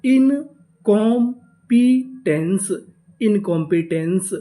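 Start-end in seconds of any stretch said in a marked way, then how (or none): 0.0-1.5 drawn out, louder, syllable by syllable; slow syllable by syllable. Shown as a demonstration. Teacher sounding out the word for students, slow and loud. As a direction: sound out the whole word slowly. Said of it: "तो हमारी पूरी meaning क्या बनी?".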